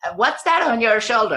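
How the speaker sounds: high, loud cheery little woodworker; annoying craftsman voice. Acted. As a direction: chirp it out like an overly friendly little craftsman.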